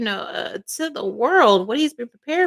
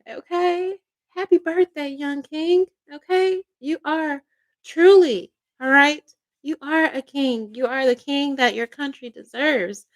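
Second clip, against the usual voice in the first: ordinary talk, nothing marked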